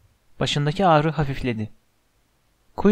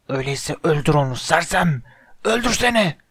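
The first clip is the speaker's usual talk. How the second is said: screamed command; 2 semitones higher than usual. A narrator's reading. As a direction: order it furiously, pitch leaping at the end. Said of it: "diye haykırdı". (haykırarak, öfkeli emir)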